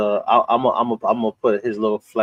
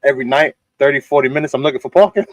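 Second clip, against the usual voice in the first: higher, louder retold complaint; exasperated friend; 4 semitones higher, 5 dB louder, faster than usual. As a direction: imitate a friend griping in exasperation. Friend's complaint acted in story.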